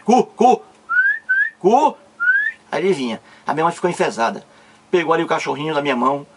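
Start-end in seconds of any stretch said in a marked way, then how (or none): 0.0-2.0 short, high, loud calls; calling the dog. Retold or imitated. Boy calling his puppy while mother is cross, high calls. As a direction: call the puppy over, word by word. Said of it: "Aí eu chamando cu".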